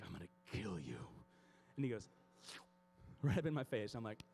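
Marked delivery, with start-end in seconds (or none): 0.0-1.0 deep, softer threat under the breath; hushed, low mock menace. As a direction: drop low and quiet, threatening the dog. Muttered threat while hugging dog.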